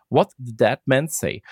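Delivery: ordinary delivery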